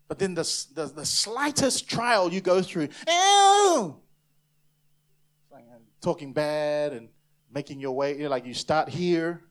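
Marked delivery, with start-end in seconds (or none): 3.1-4.0 high, loud drawn-out "ew" wail; comic recoiling yelp. Acted. Believer fleeing the slightest trial, high comic yelp. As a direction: shoot up into an exaggerated squeal.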